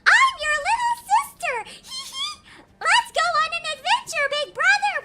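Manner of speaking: mock anime little sister, loud and squeaky; fake childish voice. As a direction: ham up a cutesy kid sister. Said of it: "you'll have people being like".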